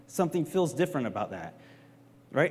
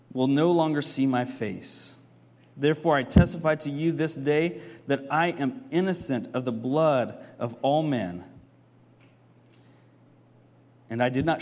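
scripture reading; 3 semitones lower than usual. Shown as a demonstration. Pastor reads the passage aloud to the church, solemn scripture reading. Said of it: "let's read on this next part".

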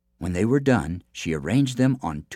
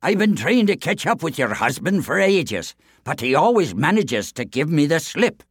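higher, louder grumbling giant; giant complaining; 8 semitones higher, 5 dB louder than usual. A narrator's reading. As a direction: grumble with pride.

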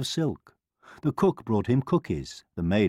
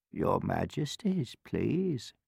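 put-on soft, deferential old woman; gentle elderly petitioner; humble old woman; 6 dB quieter, slower than usual. A narrator's reading. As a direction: plead softly and politely. Old woman humbly addresses the royals.

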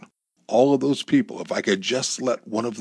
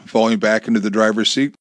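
ordinary delivery, nothing marked

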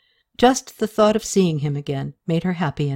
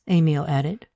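ordinary delivery

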